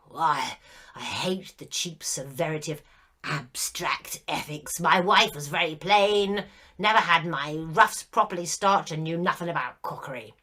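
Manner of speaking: put-on an old ghost grumbling about his wife, loud; aggrieved, sulky ghost airing old grievances; petulant ghost. A narrator's reading. Ghost's complaining monologue.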